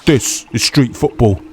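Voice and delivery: light imitation of a Cockney accent; a casual hint of a Cockney accent, slightly put on. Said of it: "a guy in a real Cockney accent".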